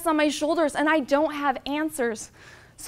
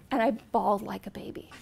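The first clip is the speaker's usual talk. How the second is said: normal talking voice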